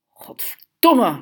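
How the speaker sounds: drawn-out, forceful angry curse; hissed curse. A narrator's reading. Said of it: "siste Tito". (nijdig gesiste vloek)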